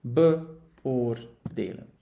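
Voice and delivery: beoordelen stretched out very slowly, syllable by syllable; teacher slowly spelling out beoordelen by ear; slow model pronunciation. Shown as a demonstration. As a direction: draw out beoordelen slowly so learners hear each part. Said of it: "To assess".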